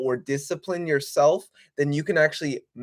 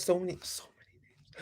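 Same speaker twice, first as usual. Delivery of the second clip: whispering; 3 semitones higher, 11 dB quieter than usual. Real, not acted. Streamer whispers aside about names.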